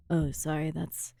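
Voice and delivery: low husky fake real voice; deep real voice. Shown as a demonstration. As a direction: drop into a low mock-man voice. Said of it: "This is my real voice".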